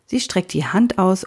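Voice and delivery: ordinary delivery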